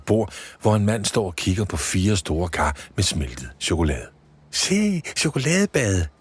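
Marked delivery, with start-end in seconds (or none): 4.5-6.1 put-on high, bright-eyed elf girl voice; a small elf thrilled by chocolate; high childlike elf delight; Polly the elf. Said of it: "siger Polly".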